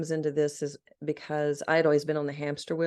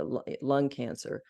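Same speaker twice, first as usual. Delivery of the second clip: ordinary talk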